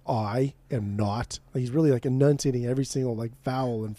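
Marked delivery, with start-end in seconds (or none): 0.1-1.4 authoritative. Retold or imitated.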